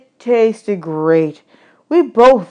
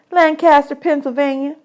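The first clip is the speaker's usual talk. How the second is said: put-on much higher, bossy little girl; 7 semitones higher than usual. Desi quizzing new boys about dishes, high bossy girl voice. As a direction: boss the new boys around.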